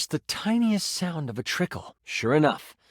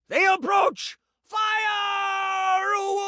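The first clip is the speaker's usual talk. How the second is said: guard shouting commands from the walls; enemy battle shout; much slower than usual. Acted. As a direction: bark the alarm from the camp walls. Game master voices shouting enemies.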